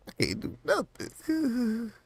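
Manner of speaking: very high, slow whining; whiny squeak. Acted. Stuck unable to pack the bowl, high slow whine. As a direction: whine it like a helpless kid.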